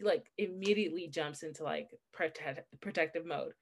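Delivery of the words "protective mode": ordinary talk, nothing marked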